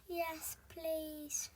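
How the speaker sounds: high, tiny, timid voice. Shown as a demonstration. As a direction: squeak it shyly. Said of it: "reader retries timid line".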